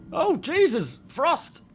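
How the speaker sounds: sudden high shriek of fright; startled scream. Real, not acted. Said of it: "you scared me to death".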